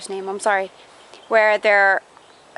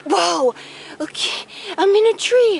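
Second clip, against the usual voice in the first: excited; 7 semitones higher than usual. Real, not acted. Surprised in a tree.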